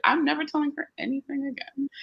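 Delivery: put-on teen vowing silence to the parent, sulky. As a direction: mutter it like a shut-down kid. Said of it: "they're gonna be like".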